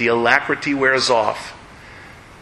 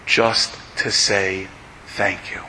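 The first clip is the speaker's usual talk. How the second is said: softer and slow, choked; tender, grateful; 4 semitones lower, 4 dB quieter, slower than usual. Acted. Father telling why he weeps at the Wall, tender. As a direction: hush it, full of gratitude.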